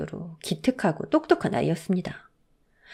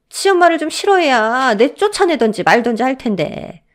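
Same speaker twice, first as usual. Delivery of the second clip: resentful grumble; 5 semitones higher, 5 dB louder than usual. Acted. Mother-in-law complains about daughter-in-law.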